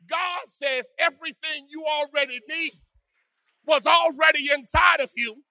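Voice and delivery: ordinary talk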